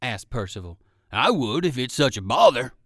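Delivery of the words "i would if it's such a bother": louder, hearty dog character; a big friendly dog offering food; old circus dog voice. Acted. Percival the dog asks.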